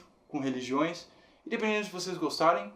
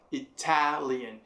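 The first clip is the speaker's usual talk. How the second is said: "Italian" with the middle "ta" stressed; much slower than usual. Shown as a demonstration. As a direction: lean hard on the "ta" in "Italian". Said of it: "Tá sendo a sílaba forte". ("Italian" com "ta" como sílaba forte)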